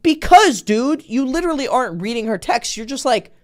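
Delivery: loud, high frustrated rant; heated exasperated voice; exasperated outburst. Retold or imitated. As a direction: vent at a clueless friend. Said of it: "And I'm like".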